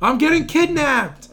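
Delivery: high, loud and slow cartoon whine. Podcaster keeps quoting, slow high cartoon voice. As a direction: drag it out in a squeaky cartoon voice.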